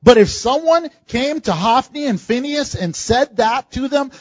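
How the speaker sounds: usual speaking voice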